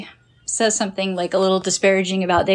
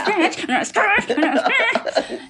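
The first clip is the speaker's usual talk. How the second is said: high, laughing repeats of scratch; 8 semitones higher than usual. Retold or imitated. Laughing at herself to her friends, high and giggly. Retelling going for the eyes.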